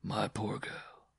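soft, slow pity; gently pitying a poor girl. A narrator's reading. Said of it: "the bareback rider said pityingly".

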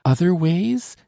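high, rising surprised question; startled, hopeful query; exclaimed question. A narrator's reading. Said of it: "Exclaimed Solange".

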